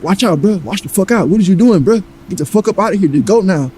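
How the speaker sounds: mock-heated shouting at the intruder; angry warning yell. Yelling at intruder he pins down.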